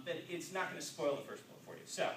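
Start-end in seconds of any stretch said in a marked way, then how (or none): none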